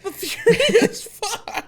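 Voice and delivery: very high, loud, drawn-out cry; hammed-up falsetto fanfare; mock-excited squeal. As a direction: squeal the name slowly with laughter in it. Hammed-up echo of the corrected name.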